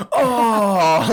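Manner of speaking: loud, high groan of disappointment; imitated crowd groan. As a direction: groan out loud like a let-down audience. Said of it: "audibly groaning".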